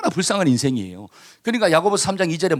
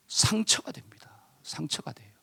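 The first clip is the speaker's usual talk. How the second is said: whisper; 9 dB quieter than usual. Shown as a demonstration. Hurt of harsh words.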